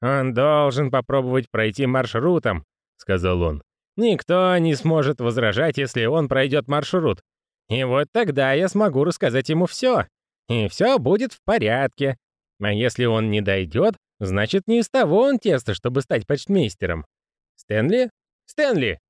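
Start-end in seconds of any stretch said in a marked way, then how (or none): 0.0-2.6 lightly voiced grumbling old man; a hint of a fretful old postman. 4.0-19.0 lightly voiced grumbling old man; a hint of a fretful old postman.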